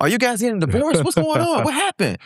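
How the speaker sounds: very high, frantic worried parent; panicked parent. Retold or imitated. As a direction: imitate a scared parent begging for news. Parents fearing bad news.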